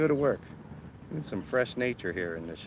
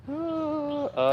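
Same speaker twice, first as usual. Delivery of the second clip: ordinary talk, nothing marked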